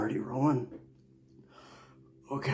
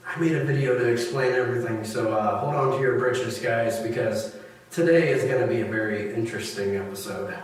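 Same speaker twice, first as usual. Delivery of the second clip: normal talking voice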